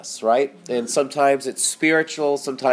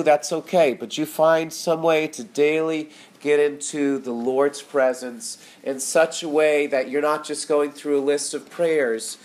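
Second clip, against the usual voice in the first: usual speaking voice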